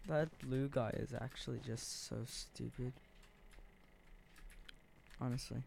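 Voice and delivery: ordinary delivery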